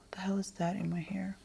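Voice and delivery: whispered aside to herself; hushed surprise at something in her hair. Real, not acted. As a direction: drop into a whisper mid-thought.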